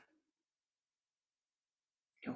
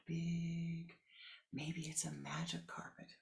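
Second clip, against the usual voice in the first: ordinary delivery, nothing marked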